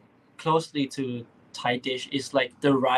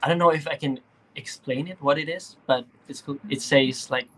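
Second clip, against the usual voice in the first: ordinary talk, nothing marked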